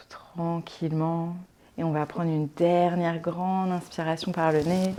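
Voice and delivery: soft, calm relaxation voice with long pauses; guided meditation. Acted. Yoga teacher settling listeners into Yoga Nidra, calm guided meditation. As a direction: ease them gently into rest, leaving space to breathe.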